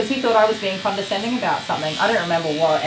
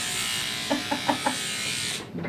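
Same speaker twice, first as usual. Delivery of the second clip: airy laugh; 8 semitones higher, 8 dB quieter than usual. Real, not acted. Laughing after joke.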